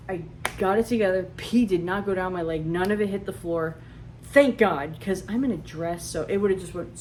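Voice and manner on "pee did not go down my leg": ordinary delivery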